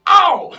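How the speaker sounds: high, very loud "ow"; loud yelp. Acted. Player acting out jumping on the bike, loud yelp. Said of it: "And I go".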